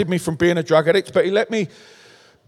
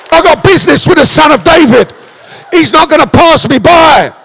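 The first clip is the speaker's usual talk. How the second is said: loud, high retort to the crowd; defiant shouting back; 6 semitones higher, 7 dB louder than usual. Acted. Blind beggar shouting back at the crowd, defiant. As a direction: fire it right back at them.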